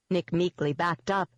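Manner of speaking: usual speaking voice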